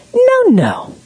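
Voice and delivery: very high, cooing "no, no"; gentle playful tone. Shown as a demonstration. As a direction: sing it sweetly to a baby. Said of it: "same words said differently".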